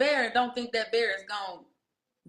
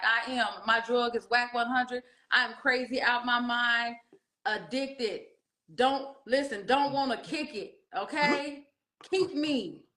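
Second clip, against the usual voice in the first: playful obsessed gushing; 4 semitones higher than usual. Retold or imitated. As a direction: gush like she's hooked. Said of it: "And I was like".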